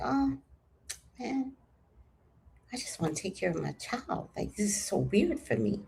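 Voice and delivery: small retold voice of dismay; quietly taken aback. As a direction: murmur it to yourself, thrown off. Taken aback by public insults.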